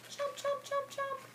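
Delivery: high, soft playful chomps; high chomping. Acted. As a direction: chomp like a snapping crocodile. Crocodile chomping sound.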